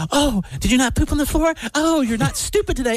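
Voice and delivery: put-on high sweet baby talk with insults; kind voice trash talk. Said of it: "I say it in a kind voice".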